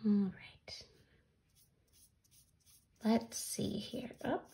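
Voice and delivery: soft whispered aside to herself; hushed murmur. Real, not acted. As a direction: whisper while concentrating. Quiet aside while painting.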